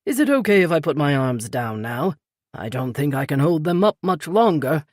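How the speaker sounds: lightly voiced older British man; a gentle hint of the polite Peter; older British gentleman, lightly voiced; Peter's voice. A narrator's reading. Peter's voice in read story.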